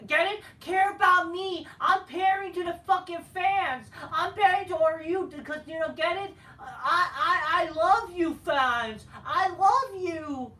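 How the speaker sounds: high, loud whiny fake sobbing; sobbing mockery of a wrestler's promo; mock crybaby. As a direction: overdo the tearful pandering. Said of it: "he basically become a crybaby".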